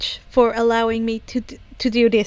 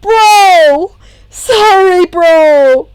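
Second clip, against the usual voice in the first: loud apology; 7 semitones higher, 10 dB louder, much slower than usual. Acted. Kaminari apologizing loudly.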